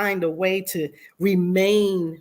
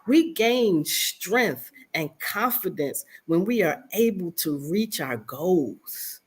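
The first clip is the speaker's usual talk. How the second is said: ordinary delivery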